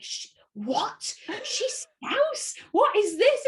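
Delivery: high incredulous squeal of surprise; mock shocked online comment. Online commenter mocking surprise at a Scouse accent, high and incredulous. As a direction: play the stunned commenter, pitch jumping up. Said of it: "the comments online were just".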